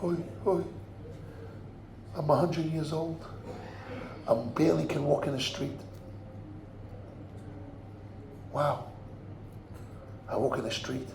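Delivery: a hint of a tired hundred-year-old sighing; weary old man. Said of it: "huffing and puffing".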